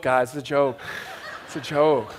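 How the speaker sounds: chuckling through the words; real laugh while reassuring the crowd; a light, laughing correction. Real, not acted. Laughs off an awkward admission.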